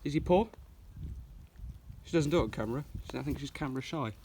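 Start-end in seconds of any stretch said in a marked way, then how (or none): none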